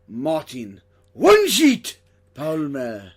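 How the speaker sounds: loud, drawn-out announcer shout; big, slow build on each name; ring announcer introduction. Acted. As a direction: announce the name like a wrestler's entrance. Big introduction of co-host's nickname.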